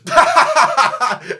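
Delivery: a loud, very high shriek of laughter; high burst of laughter. Real, not acted. Host shrieking with laughter at his own impression. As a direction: crack up in a shriek. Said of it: "Was that even Dutch?".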